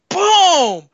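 loud high Boom!, a punch landing; punch sound effect. Retold or imitated. Retelling a punch in John Wick, loud high Boom!. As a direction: shout Boom! like a fist hitting a face.

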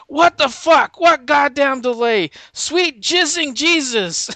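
loud, very high mock-furious outburst; hammed-up profane commander blowing up; outraged general. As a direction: explode at the soldier's bad news. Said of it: "profane and vulgar".

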